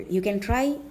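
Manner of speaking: ordinary delivery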